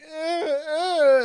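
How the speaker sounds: high, loud imitated crying grunts; mock tantrum whimpers; angry child's whine. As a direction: mimic a mad little girl's whining. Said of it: "she's like".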